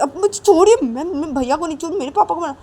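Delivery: put-on high, loud little boy; whiny child. Boy tells mother to let go, high. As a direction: wriggle free and complain.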